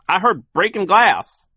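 high, slow, loud worried voice; Phil. A narrator's reading. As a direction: stretch it out high and alarmed. Phil heard glass break.

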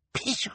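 short, very high fake achoo; tiny high-pitched put-on sneeze. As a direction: squeak out an unconvincing little sneeze. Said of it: "high-pitched sound".

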